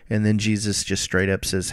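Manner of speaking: ordinary talk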